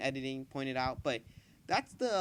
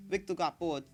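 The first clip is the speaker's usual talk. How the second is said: louder, higher character voice; wrong character's voice; 4 semitones higher, 7 dB louder than usual. Acted. Reading a suspect's alibi in the wrong character's voice, louder and higher. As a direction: read it loud and pitched up. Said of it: "wait, [name]'s reading".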